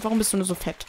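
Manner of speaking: usual speaking voice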